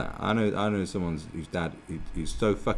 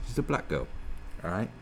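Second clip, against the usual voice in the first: usual speaking voice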